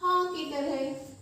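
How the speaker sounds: हा said slowly and clearly; slow demo sound. Shown as a demonstration. Teacher showing children how to say it, slowly. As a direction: model हा slowly for the class. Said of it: "उच्चारण हम किसकी तरह करते हैं?".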